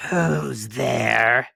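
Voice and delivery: a touch of gruff wolf; big wolf voice. A narrator's reading. Wolf in bed answering the knock. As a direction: ask it in a bigger wolf voice. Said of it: "hearing the big voice of the wolf".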